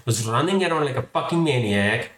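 usual speaking voice

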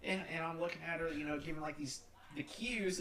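ordinary delivery

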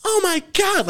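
high-pitched horrified grandma; scandalized, very high squeal; mock freak-out. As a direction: imitate a grandma shrieking in shock. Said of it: "like, fucking freaks out".

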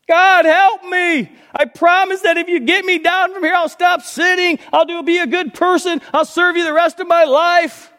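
very high, loud begging shout; desperate pleading. Acted. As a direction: beg for rescue, promising everything. Jack pleading in joke.

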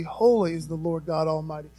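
normal talking voice

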